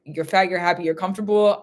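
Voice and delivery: ordinary talk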